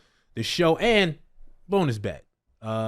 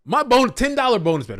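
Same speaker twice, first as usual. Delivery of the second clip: excited; 9 semitones higher, 8 dB louder than usual. Real, not acted. Excited laughing banter.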